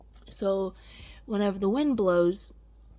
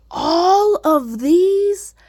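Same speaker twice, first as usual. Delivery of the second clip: awed; 9 semitones higher, 11 dB louder, much slower than usual. Real, not acted. Amazed at new shoots.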